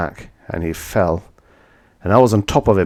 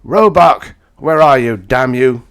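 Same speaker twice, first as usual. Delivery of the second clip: loud, higher roar across the bar; 6 semitones higher, 9 dB louder than usual. Acted. Narrator calls out his enemy in a bar, loud roar. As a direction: bellow his name in rage.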